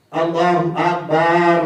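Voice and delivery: اللہ اکبر slowly with a bounced, jolted kaf; wrong qalqala takbir. Shown as a demonstration. Teacher imitates imams who add a jolt to اکبر. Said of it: "اس طرح کا تلفظ نہیں ہے".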